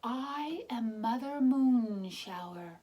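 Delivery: stately slow announcement; grand reveal. Acted. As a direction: announce yourself like a goddess. Goddess reveals herself.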